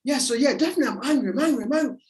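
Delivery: high, heated mock self-talk; attitude boiling over. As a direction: act out anger starting to boil over. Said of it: "to boil over".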